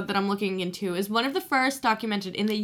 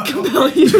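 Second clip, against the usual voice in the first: laughing; 11 semitones higher, 9 dB louder than usual. Acted. Exorcist quote, laughing.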